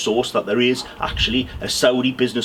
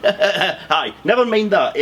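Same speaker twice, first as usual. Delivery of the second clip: laughing through it; 6 semitones higher, much slower than usual. Acted. Laughing while playing Moncur.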